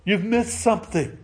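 Luther's mild triumph over the devil; triumphant exclaim. Acted. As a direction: announce it like a winner, a touch lifted. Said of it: "Luther exclaimed triumphantly".

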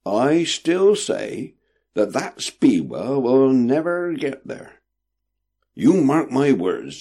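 slow and emphatic; insist, weighing every word; definite. A narrator's reading. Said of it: "with a most definite ring to his voice".

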